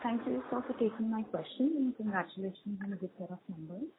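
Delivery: very soft, far from the mic; faint, low-volume voice; barely audible. Real, not acted. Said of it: "your voice is very low".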